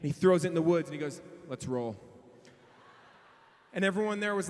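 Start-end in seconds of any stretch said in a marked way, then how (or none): 1.5-2.0 low-pitched hero one-liner; cool action hero. Retold or imitated. Cool camper after killing snake.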